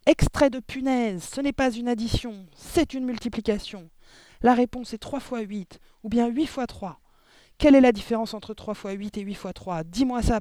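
high, loud, furious correction; yelping tirade. A narrator's reading. Headmistress correcting the pupil's sum, furious yelping tirade. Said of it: "glapit mademoiselle Le Gourdin".

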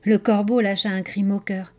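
ordinary delivery, nothing marked

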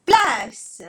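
loud haughty cry; arrogant shout. Acted. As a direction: bark it at the crowd.

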